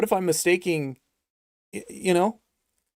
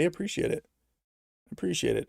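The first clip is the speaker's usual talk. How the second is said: ordinary talk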